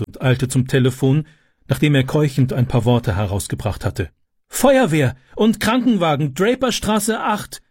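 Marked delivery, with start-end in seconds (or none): 4.5-7.6 raised, urgent emergency-call voice. A narrator's reading. Mother phones the fire brigade, calling out. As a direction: pitch it high and announce it urgently. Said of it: "rief sie".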